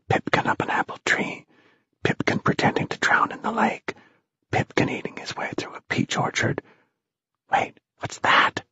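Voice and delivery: soft, hushed wonder; sustained awed whisper. A narrator's reading. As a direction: whisper each discovery to the others. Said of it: "whispered Ralph".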